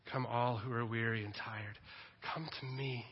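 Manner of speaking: low, soft, tender calling; gentle fatherly invitation. Acted. The Father calling the weary to him, gently. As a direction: invite softly, leaving space between phrases. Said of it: "The voice of our Father says".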